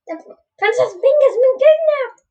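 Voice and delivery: very high squeaky alarmed voice; Toad. Acted. Player as Toad reporting a kidnapped princess, high squeaky voice. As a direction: announce it in a high, wailing squeal. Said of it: "I need a Toad voice".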